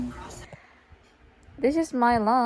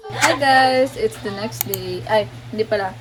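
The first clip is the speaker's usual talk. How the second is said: excited; 4 semitones higher, 10 dB louder than usual. Real, not acted. Loud excited vlog intro.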